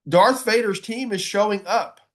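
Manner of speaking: usual speaking voice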